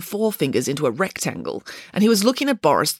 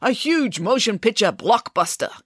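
higher-pitched showbiz sales pitch; enthusiastic; 6 semitones higher than usual. A narrator's reading. Casting director sells the film to Boris, enthusiastic. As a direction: sell it big, with keen enthusiasm. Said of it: "enthused the casting director".

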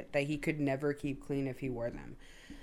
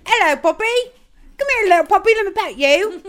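much higher, louder sing-song puppy talk; baby talk to a dog; 13 semitones higher, 12 dB louder, faster than usual. Retold or imitated. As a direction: coo at a puppy you want to pet. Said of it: "I'll be like".

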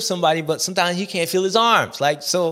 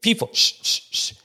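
higher call, then hissed shushes; shushing the audience; 6 semitones higher than usual. Pretend. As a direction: hush an unruly crowd. Hushing the audience to finish.

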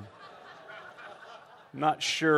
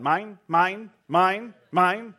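high, loud squawking birds; mock seagull chant of mine; seagull cry; 5 semitones higher, 6 dB louder, much slower than usual. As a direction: call it out like greedy gulls. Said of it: "birds that kept going".

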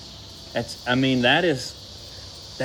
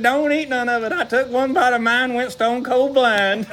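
mock panic; 9 semitones higher than usual. Marcel warning Jerry about the banana in the tunnel. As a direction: warn him like you just went blind. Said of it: "He said".

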